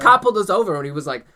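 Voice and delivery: ordinary delivery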